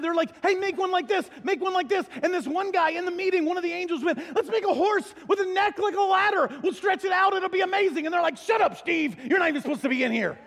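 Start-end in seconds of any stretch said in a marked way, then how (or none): 0.4-2.0 high, loud, eager pitching of ideas; hammed-up excitement; excited angels. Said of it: "the angels got excited". 4.3-8.0 high, loud, gushing pitch; hammed-up brainstorming enthusiasm; overexcited angel. Keen angel pitches silly idea. 8.4-10.4 high, loud comic telling-off; shouting down an annoying colleague; hammed-up exasperation; mock scolding. Angels scold the keen one.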